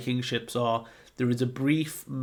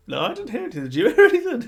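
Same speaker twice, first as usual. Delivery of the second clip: very high, loud squeaky voice breaking into laughter; giant laughing; 13 semitones higher, 9 dB louder, much faster than usual. Retold or imitated. Second giant denies hearing anything, squeaking and laughing. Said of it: "It's like".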